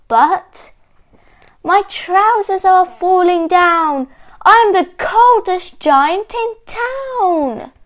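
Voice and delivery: high, loud comic lament; woeful outburst from a storybook giant; dismayed wail. A narrator's reading. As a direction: cry it out high, stretching the last words. Giant's song breaks into dismayed cry.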